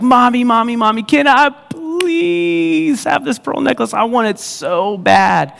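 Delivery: put-on high, slow pleading little-girl voice; little girl begging. Little Jenny begging her mom at the checkout, high pleading child voice. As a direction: beg for the necklace like a small child. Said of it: "and she's like".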